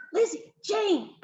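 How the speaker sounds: high, drawn-out call, urgent and hushed in intent; wild whisper. A narrator's reading. Billy calls his cousins over, whispering wildly. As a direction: stretch the names out high, wildly eager. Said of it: "Billy whispered wildly".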